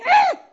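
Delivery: very high, loud mock dismay cry; dismayed groan. Other team groaning as Dave scores, high and loud. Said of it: "the other team would go".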